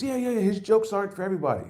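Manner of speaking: breezy driver defending the jokes; Uber driver. Retold or imitated. Comic mimics the driver on Al's jokes, high and quick. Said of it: "then he says".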